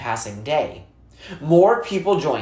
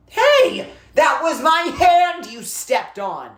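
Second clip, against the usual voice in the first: put-on high, louder protesting boy; indignant boy; 13 semitones higher, 4 dB louder, slower than usual. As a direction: yelp it out, offended his hand was stepped on. Boy protests stepped hand.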